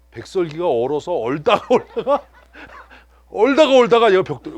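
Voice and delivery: loud laughing exaggeration. Real, not acted. Laughing at frozen rice cake. (크게 웃으며 과장해서)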